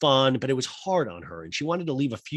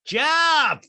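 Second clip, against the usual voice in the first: high, loud call across the house; loud called name; yell for Jeff; 8 dB louder than usual. Retold or imitated. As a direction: shout the name up the stairs. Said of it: "hangs up and yells".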